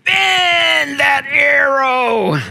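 slow, high, crying shout from the pulpit; preacher's impassioned high shout; fervent cry. Real, not acted. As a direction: cry out bend that arrow with all your heart. Sermon climax, bending the arrow.

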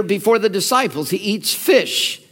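usual speaking voice

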